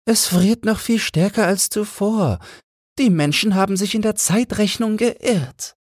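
very high, thin bird voice; a tiny chilled sparrow telling its tale; little frozen sparrow. A narrator's reading. Small frozen sparrow telling the others, very high voice. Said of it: "sagte ein kleiner verfrorener Spatz".

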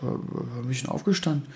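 drowsy, fading, half-asleep speech; words sinking as the eyes close; sleepy. Real, not acted. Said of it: "Ich bin sehr müde".

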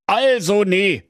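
high, scolding Berlin husband voice; grumpy Berlin husband. Acted. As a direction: nag her from the armchair. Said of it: "sagte der Werner zur Waltraud". (hoch gepresster, schimpfender Berliner Ehemann)

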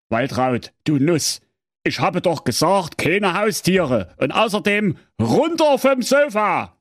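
Saxon husband voice rising to a shout; scolding husband. Acted. Husband Werner scolding wife about pets, rising shout. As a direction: snap at her to get off the sofa. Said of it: "Der Witwer Werner sprach".

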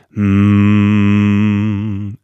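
a long, easy humming mm left to buzz; relaxed hum. Shown as a demonstration. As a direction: hum a slow, loose mm at your natural pitch. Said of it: "Also du machst einfach nur ein M-Geräusch".

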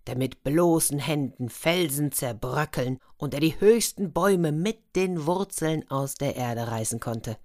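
plain reading voice

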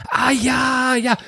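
high, quick ah ja of recognition; imitated recognition. Anka suddenly recognising the presenter, high surprised ah ja. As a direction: mimic her sudden realisation.

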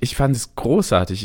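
usual speaking voice

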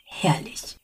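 whispered with quiet glee. Acted. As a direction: breathe it to yourself, delighted. Watcher's gleeful private thought.

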